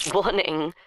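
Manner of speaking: put-on a menacing teenage boy; whiny teenage boy. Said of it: "whiny teenage boy voice".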